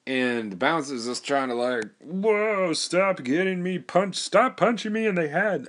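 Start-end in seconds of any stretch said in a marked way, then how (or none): 2.0-5.7 high-pitched, put-on goofy pleading; comic mock plea, pitched way up; imagined bouncer voice. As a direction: act out a big guy protesting in a silly high voice. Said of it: "That's how he talked in my mind".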